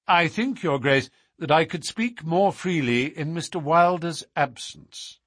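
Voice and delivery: courteous, insistent Holmes. Acted. Holmes asks the Duke for privacy, politely insistent.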